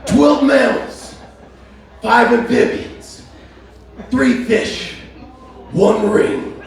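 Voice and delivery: high wrestler voice, slow punched list; Macho Man Randy Savage. Acted. Wrestler listing canyon creatures as a promo, hammed-up wrestler voice. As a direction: count them off like a hammed-up wrestler. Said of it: "What if Macho Man Randy Savage saw the Grand Canyon?".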